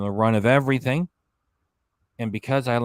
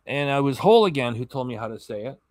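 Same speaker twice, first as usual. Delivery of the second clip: usual speaking voice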